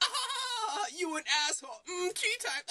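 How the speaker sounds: high-pitched imitation of a laughing mother; hammed-up high cackling mom; mock laughing mom. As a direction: squeal it through exaggerated mocking laughter. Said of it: "she laughs at him".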